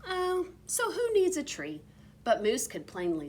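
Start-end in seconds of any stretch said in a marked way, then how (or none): 0.1-1.8 put-on kids' voice. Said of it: "They said".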